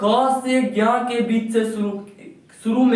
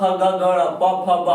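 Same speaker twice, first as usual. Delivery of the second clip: ordinary delivery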